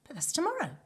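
high-pitched astonished exclamation; surprised, pitch jumping up; startled cry. A narrator's reading. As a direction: blurt it out, startled. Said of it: "cried Missus Bucket".

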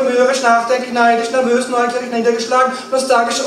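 a breathless mood catalogue swelling to a loud rush; rattled-off list. Acted.

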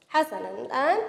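ordinary delivery

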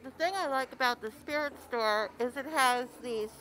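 muffled by a mask over noise. Real, not acted. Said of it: "Pardon my muffled voice".

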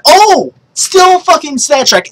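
high burst of delighted surprise; real excited outburst; hyped. Real, not acted. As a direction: exclaim it high, caught by a lucky find. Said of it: "Guys, I'm so hyped".

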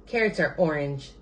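usual speaking voice

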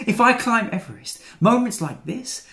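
high, loud burst of bravado; excited resolve. Acted. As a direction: declare it big, lifted high. Excited resolve to climb Everest.

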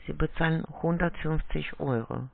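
ordinary delivery

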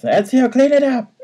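high, loud bossy yell; mock yell. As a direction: shout the order up high. Bart yelling at Ezio.

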